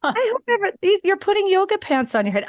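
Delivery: high-pitched incredulous reaction; mock disbelief. Acts out disbelief at trend.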